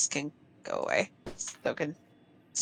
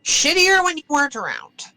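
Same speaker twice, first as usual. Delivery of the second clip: high-pitched scornful in-character retort; scoffing; 9 semitones higher, 4 dB louder than usual. Acted. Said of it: "Kinda scoffs at that".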